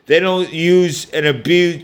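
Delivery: usual speaking voice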